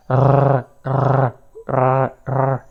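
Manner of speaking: R rolled with the tongue between the teeth, vibrating; rolled R demo. Shown as a demonstration. Teacher demonstrating the Armenian rolled R for French learners. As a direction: trill the R, tongue tip buzzing against the teeth. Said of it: "faut que la langue soit entre les dents et que ça vibre".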